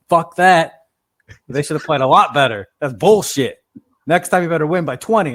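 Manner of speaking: mock angry grandpa. Grandpa ranting at the team after a loss, mock angry. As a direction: blow up at the lost game. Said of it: "My grandpa goes".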